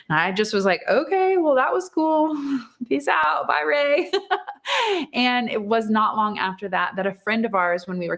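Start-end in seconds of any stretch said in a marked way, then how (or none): 0.9-4.1 high-pitched giggly sign-off, laughing through it; laughing goodbye. Retold or imitated. Retells awkward exit, laughing. 4.2-5.1 short high-pitched giggle; a bright little burst of laughter. Real, not acted. Laugh after retold exit.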